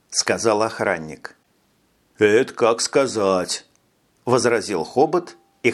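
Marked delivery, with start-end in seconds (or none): 2.2-3.7 slow menacing tough-guy line. A narrator's reading. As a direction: drawl it out as a threat. Thug before punching guard.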